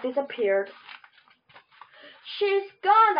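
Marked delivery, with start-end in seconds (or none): 2.4-3.2 high-pitched exclamation, slightly raised; mild excited yell. A narrator's reading. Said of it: "I yelled".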